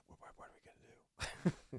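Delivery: hushed, stammered whisper; pretend whisper. Tripper asking his own reflection, mock secretive whisper. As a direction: whisper it as if conspiring with yourself. Asking oneself in the stall mirror.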